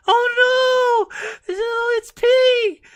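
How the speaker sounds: shrieking high and loud, slowed, mock panicked old woman; panicky old lady. Old lady shrieking as her dog pees on the counter. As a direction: scream it like a frantic grandma. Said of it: "she was like".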